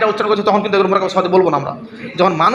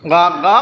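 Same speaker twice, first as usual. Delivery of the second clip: crow-like caws in a changed voice; crow caw imitation; 3 dB louder, slower than usual. Shown as a demonstration. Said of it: "অর্থাৎ কাকের আওয়াজ". (কাকের ডাকের নকল, গা গা)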